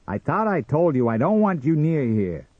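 high, loud, irritated outburst; angry cry. Acted. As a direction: cry out to drive them off. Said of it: "cried Sam".

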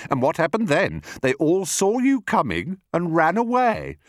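high, taunting voice; excited high jeer; scornful not-so-big crocodile. Acted. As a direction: puncture the boast with mockery.

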